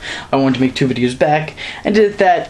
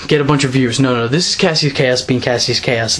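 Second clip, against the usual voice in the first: normal talking voice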